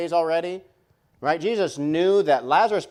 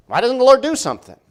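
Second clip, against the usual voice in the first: frustrated cry; 10 semitones higher, 9 dB louder, faster than usual. Acted. Preacher voicing believers' frustration.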